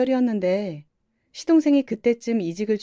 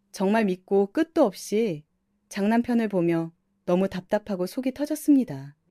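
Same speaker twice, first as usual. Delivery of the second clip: ordinary delivery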